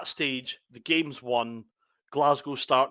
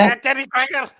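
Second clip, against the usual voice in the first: a hammed-up, much higher Scouse accent; a silly, pitched-up Scouse impression; mock Scouse accent; 11 semitones higher, 7 dB louder than usual. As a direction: squeal it in a jokey Scouse accent. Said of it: "can you do that in a Scouse accent?".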